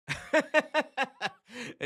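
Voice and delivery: long, high, loud chuckle; scoffing laugh. Real, not acted. Host dismissing [name] over [name] in LA, scoffing laugh. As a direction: laugh off the very idea.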